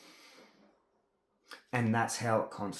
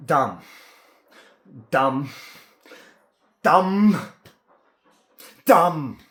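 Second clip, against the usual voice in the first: each dumb louder and higher, blurted out; stuck on one word, shouting it louder each time; building shout; 3 semitones higher, 10 dB louder, much slower than usual. Retold or imitated. Telling a lady he is clumsy but blurting dumb, building to a shout. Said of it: "I was going".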